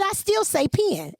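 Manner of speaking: high, slow PEN as "pee-in"; country pen. Shown as a demonstration. Host standing her ground, slow PEN as "pee-in". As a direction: stretch "pen" into a country "pin". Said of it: "Mine's was country".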